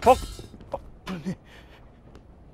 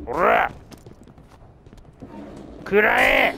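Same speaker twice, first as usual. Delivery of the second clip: screaming attack name; 3 semitones higher, much slower than usual. Acted.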